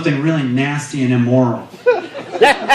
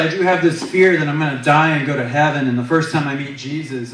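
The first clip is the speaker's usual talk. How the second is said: ordinary talk, nothing marked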